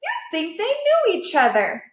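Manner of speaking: lightly voiced amused onlooker; a hint of a high dog voice; Cowboy voice. A narrator's reading.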